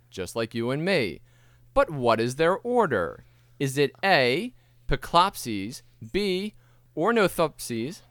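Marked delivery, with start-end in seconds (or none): 4.0-8.0 slow quiz options. Acted. Quizmaster drawing out options.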